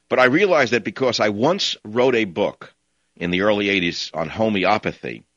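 ordinary talk

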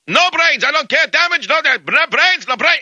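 high-pitched, relentless imitated Russian tirade; mock ranting Russian. Russian refusing an offer to pay for damage, mock ranting. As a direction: go on and on as a furious Russian, hammed up. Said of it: "he's on and on with the no brains".

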